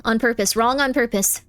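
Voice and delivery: ordinary talk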